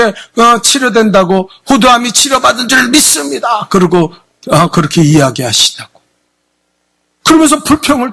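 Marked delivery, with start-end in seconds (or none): 1.7-3.7 mocking the pastor. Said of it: "그렇게 쉽게 무슨 병이 뭐 그렇게".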